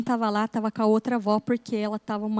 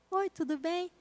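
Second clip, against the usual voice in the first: put-on small child's reply; 6 semitones higher, 8 dB quieter, slower than usual.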